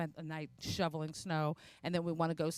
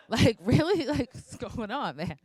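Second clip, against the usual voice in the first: higher, louder, laughing through it; laughing disbelief; 7 semitones higher, 8 dB louder, slower than usual. Real, not acted. Comic laughing at the smoking cashier, higher and louder.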